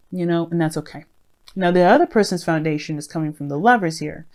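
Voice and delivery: usual speaking voice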